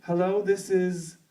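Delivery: a hint of a polite older woman on the phone; imitating Ata Maivia. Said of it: "and I'm like".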